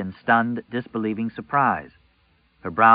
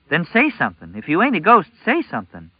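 put-on high, loud, urgent girl voice; a scared girl pleading; scared teen girl; 7 semitones higher, 4 dB louder than usual. A narrator's reading. Frightened girl demands he speak.

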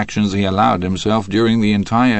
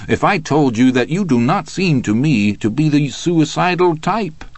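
higher-pitched, pointed questioning; needling prosecutor; 6 semitones higher than usual. A narrator's reading. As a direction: lift the voice into a sceptical challenge. DA needles defendant in cross-examination.

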